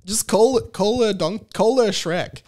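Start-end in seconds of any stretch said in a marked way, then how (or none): none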